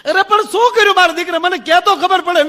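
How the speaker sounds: hammed-up anxious old mother; pleading mother-in-law. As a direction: beg her to tell what happened.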